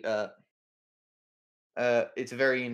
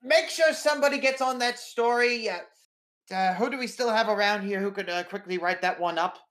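higher, louder barked orders to the intern; editor shouting orders; 8 semitones higher, 5 dB louder than usual. Acted. Editor shouting at the intern to cover the story. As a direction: bark orders across the newsroom. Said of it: "shouting at the intern".

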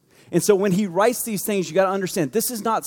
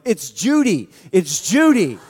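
loud mock shout from afar; hammed-up indignant call; comic calling out; 5 dB louder, slower than usual. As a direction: holler the name down to everyone. Woman in heaven calling out name.

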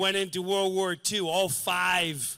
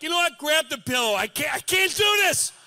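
high, loud mock exasperation; exasperated; 9 semitones higher, 6 dB louder than usual. Son giving up on his father after the third false breath, loud high. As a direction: throw up your hands, half joking.

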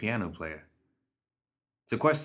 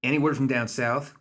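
woman outlaw voice; 5 semitones higher, 3 dB louder than usual. Acted. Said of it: "Quibble asked".

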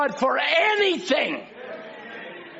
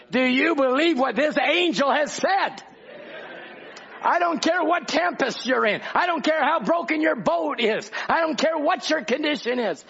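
rising preacher intensity; 5 semitones higher than usual. Acted. Building sermon climax.

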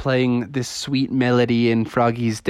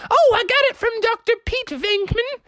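very high, showy mom voice; bragging mommy. Acted. Mommy tells neighbors about her proton pack, showing off. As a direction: pipe it up high and proud. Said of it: "And she's like".

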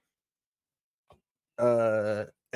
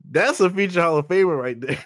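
loud, higher, laughing through a boast; barely holding back laughter; 4 semitones higher, 6 dB louder than usual. Real, not acted. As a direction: make a grand claim but crack up laughing. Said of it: "You can't even say it without laughing".

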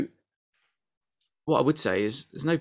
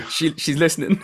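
laughing; 7 semitones higher, 5 dB louder than usual. Real, not acted. Laughing alarm.

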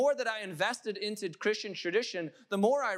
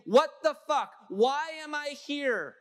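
louder, high-pitched cry to the heavens; anguished outcry; 6 semitones higher, 4 dB louder, slower than usual. Acted. Preacher voices Jesus on the cross questioning God, anguished outcry. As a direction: cry it out in raw protest, raised and loud.

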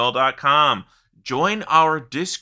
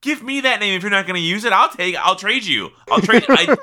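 much higher, thrilled outburst; excited; 10 semitones higher than usual. Real, not acted. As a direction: gush over the name. Thrilled by the name idea.

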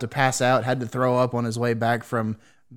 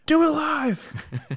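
mock rallying cry; much slower than usual. Mock battle cry to send it.

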